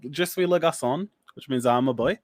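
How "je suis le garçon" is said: lightly put-on French, a bit higher; schoolboy French. Panelist shows off his only French line, lightly mock French. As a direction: recite GCSE French with mild mock flair. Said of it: "That's about the only French I remember".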